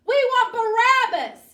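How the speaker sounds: high, full-force chanting shout; crowd yelling. Acted. The people demand Barabbas from Pilate, yelling. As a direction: yell the demand like a whole mob. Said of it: "the people started yelling".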